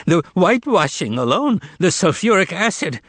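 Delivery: high, panicked babble; babbling. Acted. Terrified informer babbling, panicked. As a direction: gabble nonsense in fright. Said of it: "started babbling some nonsense".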